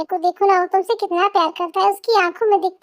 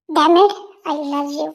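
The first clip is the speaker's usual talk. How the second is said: slow lower confession; 4 semitones lower, much slower than usual. Acted. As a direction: drag out the confession.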